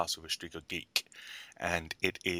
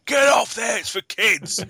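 high, full-volume angry shout; yelling man; 13 dB louder, slower than usual. Retold or imitated. Recalled man telling riders off a carousel, yelling. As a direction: bellow it like a man chasing someone off. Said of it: "the man yelling".

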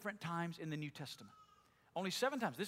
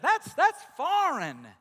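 loud; 9 semitones higher, 11 dB louder, slower than usual. Acted.

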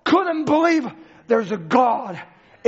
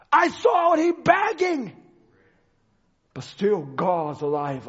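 ordinary delivery

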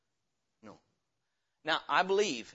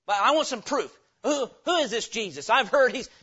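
high, loud challenge; loud demanding; 8 semitones higher, 10 dB louder than usual. Acted. Doubter demands proof about Jesus, loud and insistent. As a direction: demand answers at raised volume.

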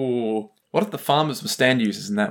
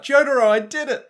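high, louder triumphant call; mock excited yell; 10 semitones higher, 4 dB louder than usual. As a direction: yell it out as an imitated victory cry. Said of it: "So he yells out".

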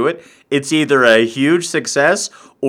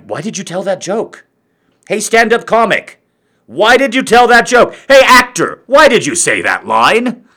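high, pestering questions getting louder; mock heckler; 7 semitones higher, 6 dB louder than usual. Asshole audience member challenging a comic and an actor, heckling. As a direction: call out accusingly, pitched high, ending loud. Acts out heckling other performers.

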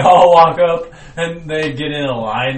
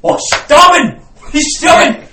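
much higher, loud frantic cry; panicked shriek; 12 semitones higher, 8 dB louder than usual. Acted. Pooh crying out in panic as the kangaroos stab him. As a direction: scream like you're being stabbed.

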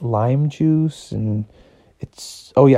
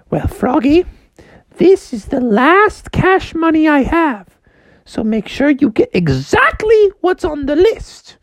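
mom voice. Retold or imitated. Mom calling Froggy to fetch ingredients, high and sweet. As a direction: sing out to your son sweetly. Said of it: "Froggy's mom said".

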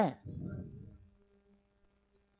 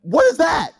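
mock-furious, high-pitched disbelief; indignant outrage; 6 semitones higher than usual. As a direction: huff like an insulted general. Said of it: "He's like".

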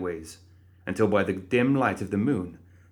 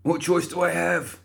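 choked rasp; squeezed throat rasp; 9 semitones higher than usual. A narrator's reading. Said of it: "he rasped".

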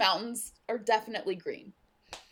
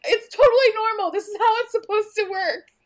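high, loud imitation of hurried reassurance; mock frantic explaining; 13 semitones higher, 8 dB louder than usual. Retelling Henry trying to explain, high mock frantic. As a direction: insist over the panic, pitched way up.

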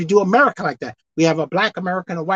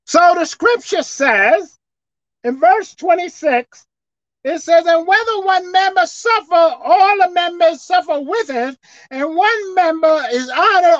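slow, raised preaching proclamation; 10 semitones higher, 7 dB louder, slower than usual. Acted. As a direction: lift the verse up to the congregation, slowing down. Preacher reads scripture, proclaims.

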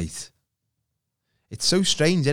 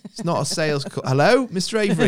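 high, insistent telesales voice; mock chummy sales caller; over-friendly cold caller; 9 semitones higher, 5 dB louder than usual. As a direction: call out brightly to a lost customer. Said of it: "over friendly bollocks from someone".

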